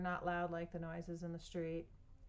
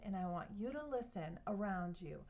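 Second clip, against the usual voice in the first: plain reading voice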